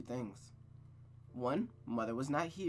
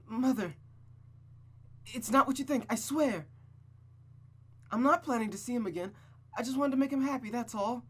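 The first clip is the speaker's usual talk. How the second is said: put-on high, loud, anxious girl's voice; frightened pleading; 10 semitones higher, 5 dB louder than usual. Rosa begging her mother not to misunderstand, high and scared. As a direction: plead fast before she's punished.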